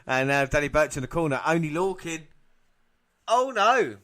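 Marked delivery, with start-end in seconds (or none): 3.3-4.0 high-pitched commentator's cry; excited ringside outburst; alarmed exclamation. Real, not acted. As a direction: react in sudden alarm at the action. Reacting live to a move.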